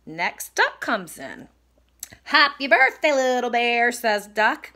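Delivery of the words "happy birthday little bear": loud storybook duck's greeting, pitch starting high; lightly acted party greeting; cheery birthday greeting. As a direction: call out a festive hello. Duck greets Little Bear on birthday.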